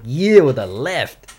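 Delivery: slow, drawn-out boastful "yeah"; retold pride. Recalling splitting his eyebrow with the left, mock proud crow. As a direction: crow it slowly, imitating pride. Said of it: "I was all proud".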